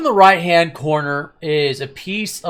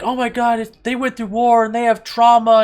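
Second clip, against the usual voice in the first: higher-pitched mock concern; put-on high voice parroting someone's excuse; mimicked online commenter; 9 semitones higher than usual. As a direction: imitate a fretting commenter in a raised pitch.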